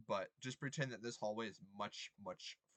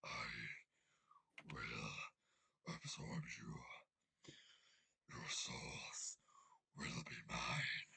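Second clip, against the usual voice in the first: very low, slow, whispery monster; booming dragon voice; 11 semitones lower, 4 dB quieter, much slower than usual. Acted. Game master voicing a dragon threatening the party, deep slow voice. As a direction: draw it out deep and menacing. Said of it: "you hear a booming voice".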